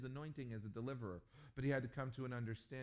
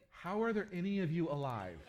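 louder, higher amazed question with a laugh; incredulous; 7 semitones higher, 8 dB louder than usual. Retold or imitated. Pastor asking his Australian host, incredulous. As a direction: ask it half laughing in disbelief.

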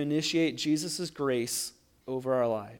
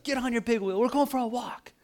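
higher-pitched motherly order; exasperated mom; 8 semitones higher than usual. Retold or imitated. Mom ordering her screaming child out, mock exasperation. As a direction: imitate a fed-up mother giving orders.

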